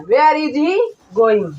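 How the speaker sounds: slow dictation. Shown as a demonstration. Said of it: "teacher dictates slowly".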